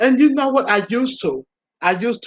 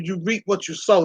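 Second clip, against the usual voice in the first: usual speaking voice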